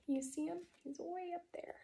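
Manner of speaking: put-on hushed aside to the kids; soft whispered aside to the class. A narrator's reading. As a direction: whisper it like pointing out a secret.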